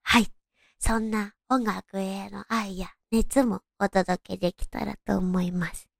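ordinary talk

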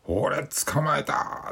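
high loud slow triumphant cry; triumphant sleep talk. Retold or imitated. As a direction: shout it like you caught it. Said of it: "って言って".

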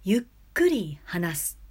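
slow demo of slow talking; slow speech. Shown as a demonstration. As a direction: draw it out slowly. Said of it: "緩急というのは".

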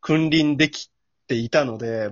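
usual speaking voice

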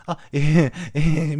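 a loud, flustered sound of confusion, acted out; retold flustered disbelief; mildly baffled. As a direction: react with hammed-up bewilderment. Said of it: "みたいになってさ".

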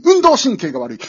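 ordinary talk, nothing marked

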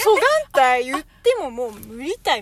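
loud exasperated scolding; angry dialect scold. Retold or imitated. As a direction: scold someone sharply. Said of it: "怒るときに使わん？". (怒って叱る言い方)

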